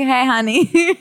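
a lightly voiced flirty hostess greeting; sassy hostess. Cohost imitates a hostess greeting customers. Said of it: "No, you wouldn't talk like that".

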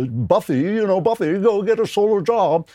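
high-pitched mocking caricature; Gilligan's Island rich man. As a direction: lampoon a condescending toff. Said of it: "sounds just like that guy on Gilligan's Island".

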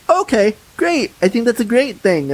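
a lightly voiced upbeat mom; mom's happy approval. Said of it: "she goes".

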